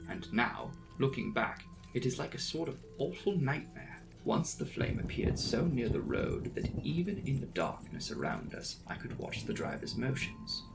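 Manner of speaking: ordinary delivery, nothing marked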